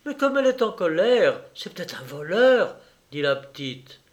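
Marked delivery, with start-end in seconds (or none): 0.1-2.8 put-on high-pitched, animated girl; girlish speculation, pitched up; wondering young girl. A narrator's reading. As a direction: voice a girl struck by a sudden idea. Younger girl wonders about a thief.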